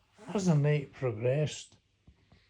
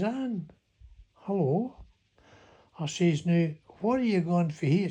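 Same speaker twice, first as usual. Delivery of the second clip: higher, louder, bright eager hello; eager greeting; 8 semitones higher, 4 dB louder than usual. Retold or imitated. Man greeting Anne as she steps off the bus, eager and raised. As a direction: call out to her keenly, a bit higher.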